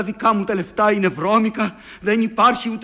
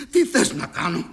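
usual speaking voice

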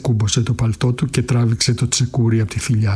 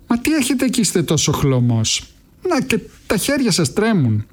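put-on much higher, prying old pawnbroker; old woman eyeing his pale face, higher; suspicious old woman; 9 semitones higher than usual. A narrator's reading. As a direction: probe him with mock concern. Old woman remarks his pallor.

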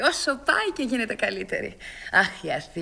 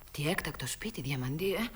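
soft whisper behind her hand; whispered aside; 9 semitones lower, 9 dB quieter than usual. Acted. Elena to her husband about the grand house, a soft whisper. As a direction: breathe it to her husband so Sofia won't hear.

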